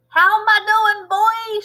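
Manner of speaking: high, loud gloating shout. Acted. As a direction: crow down at the boy from the roof. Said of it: "she shouted".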